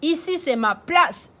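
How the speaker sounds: high loud bossy order; the chief barking his order; police chief ordering. Acted. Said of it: "dit le chef de police". (le chef ordonne fort)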